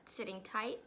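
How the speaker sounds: ordinary delivery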